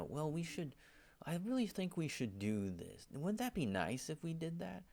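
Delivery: mock passive-aggressive sweetness. Church gossip nudging others her way, mock sugary voice. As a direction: imitate a sugary hint that is really a demand. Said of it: "they, they say stuff like that".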